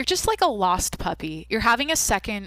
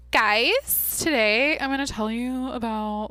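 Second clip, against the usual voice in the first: bright, higher influencer intro voice; Valley Girl voice; 4 semitones higher, slower than usual. Pretend. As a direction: greet your followers like a beauty vlogger. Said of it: "put on a Valley Girl voice".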